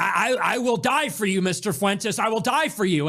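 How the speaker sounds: a fan swearing loyalty, over the top and pleading; mock devoted fan.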